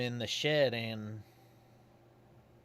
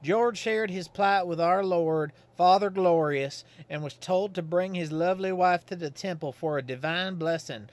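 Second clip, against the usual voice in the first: put-on folksy old Godfrey; 6 semitones higher, 6 dB louder than usual. Godfrey calls at Susie's house, folksy and higher. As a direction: play him as a simple, cheerful Southern townsman.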